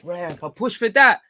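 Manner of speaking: high, loud outburst at the ref; outraged protest. Real, not acted. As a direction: shout in rising disbelief at the call. Outraged at referee's foul decision.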